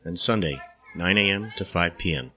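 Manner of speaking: low, soft sponsor read. Acted. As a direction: read it like a radio ad. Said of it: "I love your voice when you do those".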